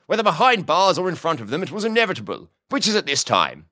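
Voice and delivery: high-pitched, jovial lordly boss; Lord Huxley. A narrator's reading. Boss explains himself to Boone, jovial. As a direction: chatter on grandly in a high character voice.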